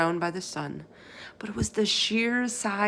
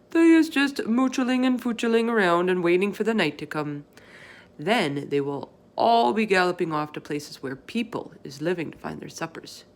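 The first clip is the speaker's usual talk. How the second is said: BFG voice; 4 dB louder than usual. Acted. Giant explaining to Sophie what the others do, folksy. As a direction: play the big friendly giant, chatty and earnest.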